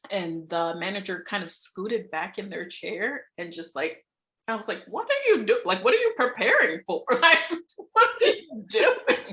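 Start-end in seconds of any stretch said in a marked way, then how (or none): 4.9-9.3 high, loud mock disbelief breaking into laughter; incredulous retelling. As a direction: re-enact a baffled challenge, pitch leaping up. Retold incredulous challenge, ends laughing.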